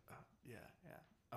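breathy whispered stalling; hushed nervous reassurance. Retold or imitated. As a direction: whisper it, brushing them off. Clerk stalling customer.

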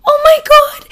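imitated joyful dad shriek; high, loud, overjoyed outburst; happy screaming. Dad hears daughter's news, happy screaming. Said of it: "screaming and, like, so happy".

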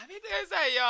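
high laughing protest; laughing plea. Shown as a demonstration. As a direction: beg through laughter. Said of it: "これです".

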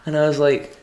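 ordinary talk, nothing marked

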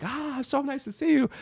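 way up high, airy and spaced out; high-pitched spaced-out greeting; high dazed voice. Retold or imitated. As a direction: play the high-on girl sweetly. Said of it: "she's like".

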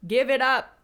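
loud, fed-up outburst; exasperated shout. Real, not acted. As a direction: yell it like you're done with this. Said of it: "Said it before, I'll say it again".

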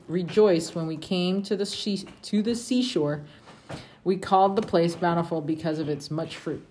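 steady, measured reading of scripture. Acted. Parent reading scripture to the family, measured and steady. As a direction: read it aloud to the family like scripture.

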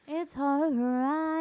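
slow, stretched-out consoling line; drawn-out reassurance. Real, not acted. Co-host consoling friend after a slip, drawn-out reassurance. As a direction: draw it out long and slow.

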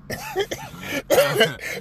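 bursts into a high cackle; real gleeful cackle; high cackling laugh. Real, not acted. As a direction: cackle at your own scheme. Laughs at own story.

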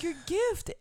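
high and urgent, rising at the end; urgent warning. Retold or imitated. Wife warning husband his gift is right there, high and urgent. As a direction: blurt it out in alarm.

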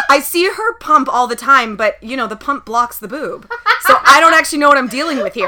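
loud laughing plea. Real, not acted. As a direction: laugh through a cheeky request. Laughing request to listener.

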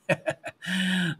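a bright burst of real laughter. Real, not acted. Laughing to the host about chairlift rides.

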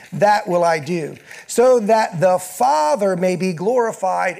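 loud scripture reading, pressing each line; building preaching. Acted. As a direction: proclaim the promise to the congregation. Reads scripture with rising force.

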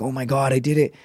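usual speaking voice

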